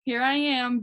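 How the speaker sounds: high, mock-sneaky giggle voice; sly gloating. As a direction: ham up a greedy recruiter spotting money. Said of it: "and I'm like".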